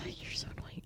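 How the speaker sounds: whispered aside. Real, not acted. Aside to a friend.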